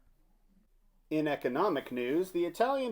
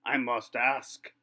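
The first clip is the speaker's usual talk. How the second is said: ordinary talk, nothing marked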